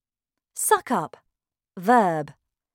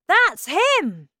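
high, loud cry of recognition; excited shout; 12 semitones higher, 7 dB louder than usual. A narrator's reading. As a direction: shout it out from the crowd. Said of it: "somebody shouted".

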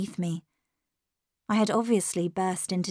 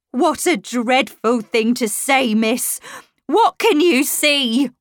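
louder, dismayed outcry; crying out; 4 semitones higher, 6 dB louder than usual. A narrator's reading. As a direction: cry out in shocked protest. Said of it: "Missus Grose cried out".

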